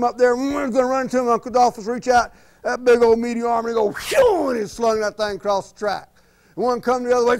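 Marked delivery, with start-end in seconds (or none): 3.9-4.5 very high whooshing swat sound; mock swat noise. Uncle Dolphus slings a bumper car across the track, high whoosh.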